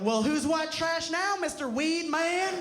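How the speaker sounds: high, slow, sing-song taunt; mocking mom. Mom turns it on her son, mocking him in a high, slow taunt. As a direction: mom gloating in a high, drawn-out voice. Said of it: "she's all like".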